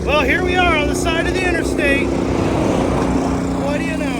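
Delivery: high, loud roadside announcement. Real, not acted. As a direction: project wryly over the traffic. Roadside after breakdown, raised voice.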